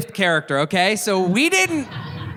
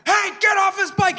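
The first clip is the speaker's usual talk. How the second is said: much higher imitated shout; retold scream; 13 semitones higher than usual. Stranger screams at the bike thief, high imitated shout. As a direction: bark it like a stranger defending you. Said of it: "He starts screaming at him".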